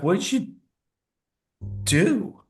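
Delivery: playing the horrified wife; shocked wife. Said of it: "she's like".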